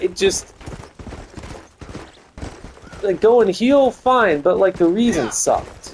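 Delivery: exasperated. Real, not acted. Streamer ranting to chat about a bad storyline, exasperated. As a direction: vent the frustration, rising.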